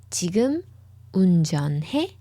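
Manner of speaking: questioning end on 운전해; rising question intonation. Shown as a demonstration. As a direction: turn it into a question with a lift on 해. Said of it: "Are you driving now?".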